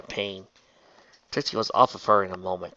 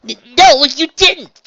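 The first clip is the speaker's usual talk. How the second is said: high, loud stammered denial; insistent; 16 dB louder, slower than usual. A narrator's reading. Trixie contradicts Raindrops' guilt, insisting. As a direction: push back hard and fast on the claim. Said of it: "Trixie insisted".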